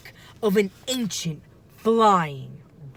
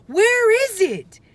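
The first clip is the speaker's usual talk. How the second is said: frantic; 6 dB louder than usual. A narrator's reading. Jack searching frantically.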